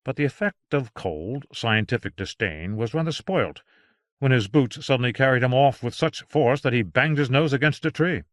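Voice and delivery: ordinary delivery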